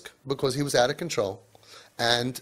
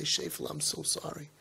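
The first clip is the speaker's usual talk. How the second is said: much softer, hushed soothing; tender comfort; 3 semitones higher, 6 dB quieter than usual. Retold or imitated. Parent soothing a child who broke something, soft and tender. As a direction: comfort a hurting child gently. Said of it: "No, you're gonna say".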